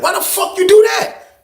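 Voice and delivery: high-pitched outraged question; incredulous shout. Retold or imitated. Inmate confronting neighbour over the flood, incredulous shout.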